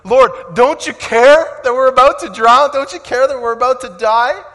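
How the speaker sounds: loud, high, desperate cry over the storm; panicked shout. Acted. Disciples cry to sleeping Jesus in the storm, panicked shouting. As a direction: yell it like you're about to drown.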